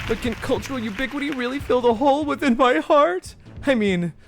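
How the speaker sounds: high, sob-laughing villain who pities himself; a killer wailing crocodile tears between cackles; weepy villain voice. Acted. Voices the Weepster boss.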